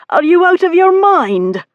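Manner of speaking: high, loud, appalled outburst; gasping disbelief. A narrator's reading. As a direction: burst out in disbelief after a gasp. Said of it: "he continued between gasps".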